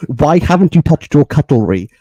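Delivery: loud, exasperated demand; acted indignant outburst. Retold confrontation over an untouched meal.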